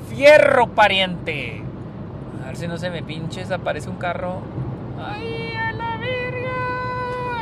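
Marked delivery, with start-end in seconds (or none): 0.1-1.6 hyped shout. Real, not acted. Hyped driving past trucks. 5.0-7.4 high-pitched shocked cry; startled yelp. Real, not acted. Startled by car while driving.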